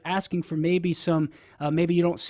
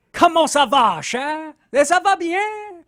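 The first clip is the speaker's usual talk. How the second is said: put-on high, slow, playful Cajun French accent; Cajun French greeting; 11 semitones higher, 5 dB louder, slower than usual. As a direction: sing out the Cajun French hello. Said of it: "That's Cajun for".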